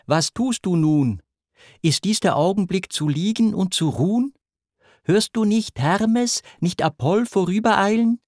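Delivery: high-pitched reproachful lament. A narrator's reading. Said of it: "klagte sie". (klagend, mit erhobener Stimme)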